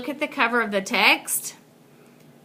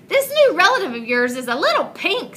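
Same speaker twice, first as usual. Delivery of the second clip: teasing raccoon; 7 semitones higher, 4 dB louder, faster than usual. Acted. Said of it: "said the raccoon".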